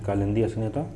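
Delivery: ordinary talk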